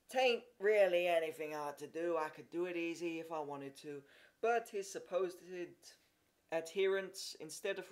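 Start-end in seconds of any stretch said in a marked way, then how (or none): 0.1-4.0 offhand, airy boast. A narrator's reading. Horace dismissing the feat to the crowd, airy. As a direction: toss it off lightly. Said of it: "he said airily".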